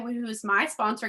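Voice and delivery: ordinary talk, nothing marked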